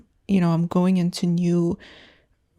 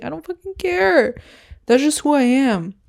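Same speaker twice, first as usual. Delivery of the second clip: sudden high, louder defiant burst; defiant outburst; 7 semitones higher, 4 dB louder than usual. Real, not acted. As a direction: throw it out, done caring what they think. Defiant outburst about being ghosted.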